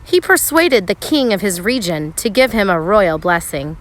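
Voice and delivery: normal talking voice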